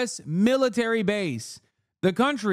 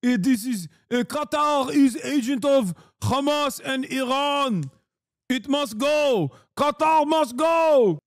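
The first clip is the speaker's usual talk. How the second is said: reactionary Israeli politician; 5 semitones higher than usual. Acted. Streamer mocking Jeffries as a Knesset hardliner, exaggerated Israeli accent. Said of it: "like a reactionary Israeli member of the Knesset".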